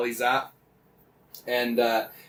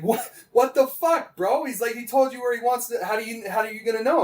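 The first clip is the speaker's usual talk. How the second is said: much higher, frantic thug voice; high-pitched, panicky disbelief; panicked raider; 10 semitones higher than usual. Retold or imitated. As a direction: squeak it out like a terrified captive. Panicked raider seeing buddy killed.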